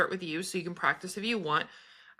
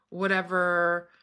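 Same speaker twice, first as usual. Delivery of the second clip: normal talking voice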